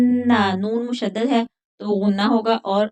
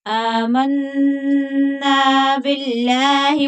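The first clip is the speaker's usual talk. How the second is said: slow, drawn-out melodic tajweed chant; Quran recitation; 4 semitones higher, 5 dB louder, much slower than usual. Acted. Teacher reciting the verse to students before explaining it, slow melodic chant. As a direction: recite slowly, stretching each long vowel.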